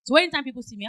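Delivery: normal talking voice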